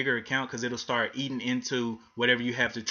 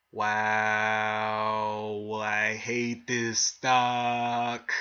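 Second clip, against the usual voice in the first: drawn-out, slow disgusted wow; slow fed-up drawl at a bad chart; exasperated groan; 2 semitones lower, much slower than usual. Real, not acted. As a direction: let the stock's drop wring it out of you slowly. Frustrated watching stock drop.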